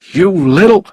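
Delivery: loud, high-pitched furious outburst; angry shout. A narrator's reading. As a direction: shout it as you lash out. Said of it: "he shouted as he hit Mark".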